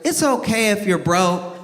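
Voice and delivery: put-on high, matter-of-fact little girl; a lightly voiced kid, innocently cutting. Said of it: "she goes".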